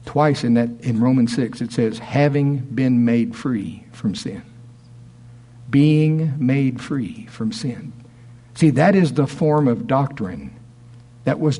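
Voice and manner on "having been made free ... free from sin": slow and deliberate. Acted. Slow scripture emphasis.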